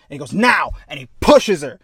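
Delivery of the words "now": sharp loud higher shout; captain shouts to the crew; shouted command. Retold or imitated. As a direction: bark the order. Captain orders push through door.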